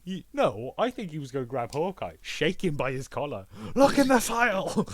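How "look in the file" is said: much higher and louder, shouted command; mock shouted order; loud high yell. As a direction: shake someone and yell it at them. Watcher shaking Hawkeye by the collar.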